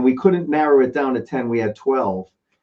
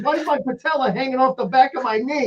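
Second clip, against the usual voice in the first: much higher mock complaint about the knee; Bob in pain; 9 semitones higher, 3 dB quieter than usual. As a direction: whine about the broken knee. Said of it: "He goes".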